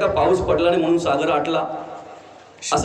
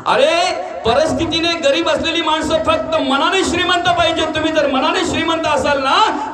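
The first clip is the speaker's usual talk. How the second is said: preaching cry; 7 semitones higher than usual. Real, not acted. Preacher exhorts the crowd.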